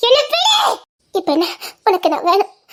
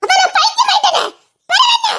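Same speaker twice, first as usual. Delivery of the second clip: hysterical laughing shout; 10 semitones higher than usual. Acted. Wife breaks down, hysterical. (உன்மத்தமான சிரிப்புடன் கத்தல்)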